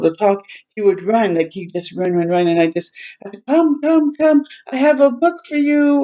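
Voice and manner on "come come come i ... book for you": retold coaxing call.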